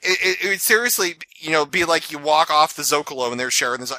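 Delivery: ordinary talk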